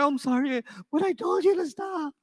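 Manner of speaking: put-on a kid suddenly serious; serious upset kid. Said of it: "a very serious stop it".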